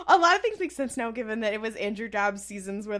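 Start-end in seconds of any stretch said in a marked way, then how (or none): 0.1-1.1 gasp, then rush it out higher and louder; fast, excited dawning realization; sudden realization. Real, not acted. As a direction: it all just clicked.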